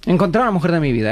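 fast excited inner thought; dreamy inner voice. Retold or imitated. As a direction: rush the lovestruck thought. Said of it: "y yo diciendo".

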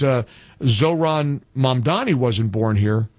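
ordinary talk

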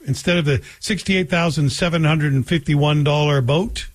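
usual speaking voice

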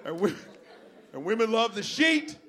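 normal talking voice

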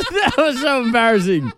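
high-pitched, embarrassed laughter through the words; laughing. Real, not acted. Radio host embarrassed by own failed baby voice, talking through laughter. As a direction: crack up while protesting. Cracks up after failed attempt.

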